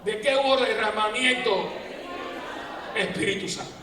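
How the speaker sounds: loud preaching question; preaching call. Acted. Priest rousing the congregation, loud.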